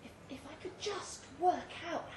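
breathless desperate whisper; barely voiced, clinging to a thought. Trapped narrator's desperate thought.

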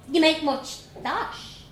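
put-on high, bold Dublin girl voice; young Dublin girl. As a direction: play a cheeky little Dublin girl. Vanessa asks.

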